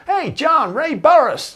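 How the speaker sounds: high, hearty holler; booming voice. Retold or imitated. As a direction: call out a big introduction. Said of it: "this booming voice".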